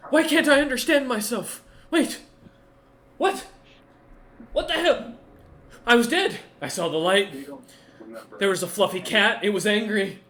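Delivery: loud, high-pitched frantic creature outburst; screaming. Acted. Game master voices revived creature raving in Primordial, screaming. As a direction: cry out in bursts, high and loud. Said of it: "you hear it start screaming in Primordial".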